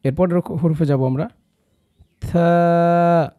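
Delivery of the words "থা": থা said thin and light, drawn out; thin light letter. Shown as a demonstration. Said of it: "খুবই পাতলা করে এবং চমৎকার করে বলার চেষ্টা করুন". (থা খুব পাতলা করে)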